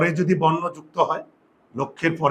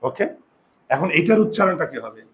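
normal talking voice

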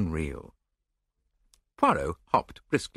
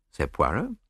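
usual speaking voice

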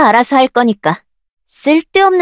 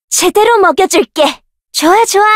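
higher eager taunt; 7 semitones higher than usual. Acted. Fighter eagerly taunting a foe, higher. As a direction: stress on 제대로, pitched up and falling.

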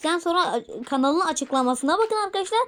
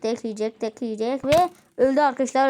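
ordinary talk, nothing marked

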